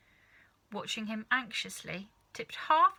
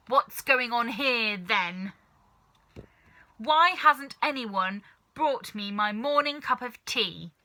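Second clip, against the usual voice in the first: shouting grandma; 3 semitones higher, 8 dB louder than usual. A narrator's reading. Grandma shouting at the three below, loud furious voice. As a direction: bellow down from a great height. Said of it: "she shouted".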